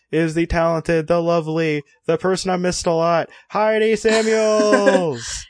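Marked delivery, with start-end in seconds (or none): 3.5-5.4 drawn-out hype introduction; announcer intro. Acted. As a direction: announce her like a game show host. Announcing the guest host.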